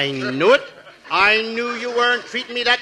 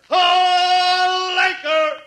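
drawn-out shout back; long bellowed echo; 7 semitones higher, much slower than usual. Acted. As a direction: echo the order slowly. Echoing the shouted command.

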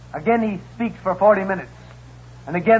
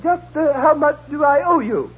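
raised prim lady voice; 9 semitones higher, 4 dB louder than usual. Acted. Customer to porter, a raised fussy lady voice. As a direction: play the fussy rich lady.

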